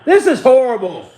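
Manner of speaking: loud, exasperated rant at the defense; angry outburst. Real, not acted. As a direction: yell your disgust at the TV. Fan furious at defense collapse.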